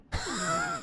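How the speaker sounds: high squeaky laugh; cartoon laugh. Real, not acted. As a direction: burst into a squeaky giggle. Laughs at a zoomed-in image.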